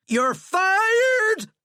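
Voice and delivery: very high, loud screech; furious screech. A narrator's reading. President sacking his general, screeching. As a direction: screech it in a rage. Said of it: "Flannery screeched".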